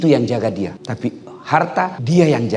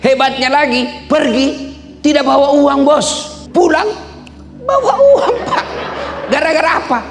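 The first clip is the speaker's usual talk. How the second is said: high-pitched, emphatic rally delivery; preach; 14 semitones higher than usual. Real, not acted. Preacher boasting to officials what knowledge earned him, high fervent preaching. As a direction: pitch it high and punch each phrase out to the hall.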